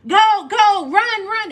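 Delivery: high, loud urgent yell. Retold or imitated. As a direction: shoo everyone out fast. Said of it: "yell at them".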